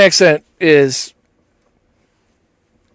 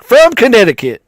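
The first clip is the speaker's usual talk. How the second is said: higher, hammed-up Connecticut accent; put-on Connecticut accent; 5 semitones higher, 3 dB louder than usual. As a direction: answer in a mock Connecticut accent. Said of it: "I'd be like".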